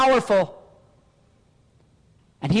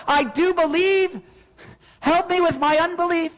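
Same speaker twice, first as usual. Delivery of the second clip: a pleading cry to God, raised higher; 6 semitones higher than usual. Retold or imitated. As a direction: plead it out like a desperate prayer. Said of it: "It's why you cry out".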